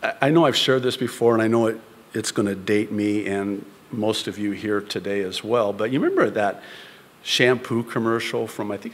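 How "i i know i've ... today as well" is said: ordinary talk, nothing marked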